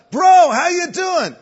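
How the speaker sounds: high, loud, slow gushing greeting. Retold or imitated. Stranger greeting Greg with overfriendly, high loud enthusiasm. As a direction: greet him like an old friend.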